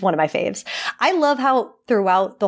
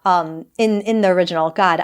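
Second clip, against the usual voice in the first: ordinary talk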